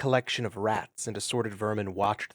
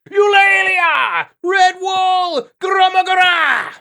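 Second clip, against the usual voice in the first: much higher, loud slow battle shouts; war cries; 11 dB louder, much slower than usual. Acted. Crew charging downhill at vermin, loud war cries. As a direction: roar the charge. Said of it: "War cries rang out".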